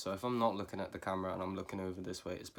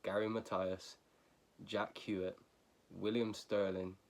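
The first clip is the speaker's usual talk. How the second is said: ordinary talk, nothing marked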